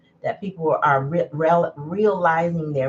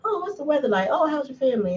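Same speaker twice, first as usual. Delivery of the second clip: lightly mocking chirpy small talk, pitched higher; higher, mildly sing-song fake-polite chatter; 8 semitones higher, faster than usual. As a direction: parody polite chitchat, a touch higher. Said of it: "I can't sit there".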